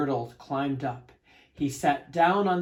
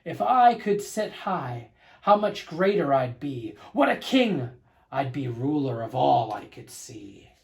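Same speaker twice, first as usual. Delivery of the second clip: boastful king; 6 dB louder than usual. Acted. Yertle imagining how great he would be, loud grand boast. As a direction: swell with royal vanity. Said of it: "Yertle dreams of a taller throne".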